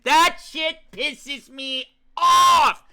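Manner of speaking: slow, furious yell at the game; angry shout. Real, not acted. Streamer raging at the game's twist, slow furious shout. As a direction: blow up, dragging each word out.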